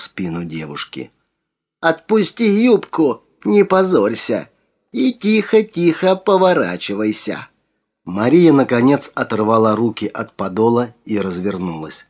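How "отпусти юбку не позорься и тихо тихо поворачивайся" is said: put-on old woman whispering. A narrator's reading. The old woman's threat continues.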